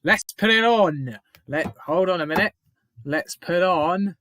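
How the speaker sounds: loud, high, stretched-out words; excited celebrating. Real, not acted. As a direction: whoop it up after a goal, drawing words out. Celebrating the goal.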